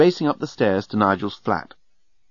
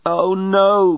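louder, drawn-out groan of dismay; 7 semitones higher, 5 dB louder than usual. A narrator's reading. Nettie hitting yet another traffic jam, groaning. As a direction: groan it out, pitched up. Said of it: "groaned Nettie".

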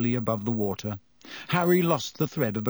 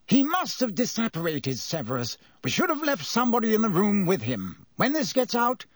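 higher, fretful official's voice; worried minister; 9 semitones higher than usual. A narrator's reading. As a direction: fuss like a flustered politician.

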